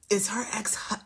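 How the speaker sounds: breathy whispered aside. Real, not acted. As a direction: drop to a whisper mid-rant.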